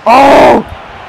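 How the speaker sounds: shocked cry at the screen; startled shout. Real, not acted. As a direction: yelp at the near miss. Shocked shout at near goal.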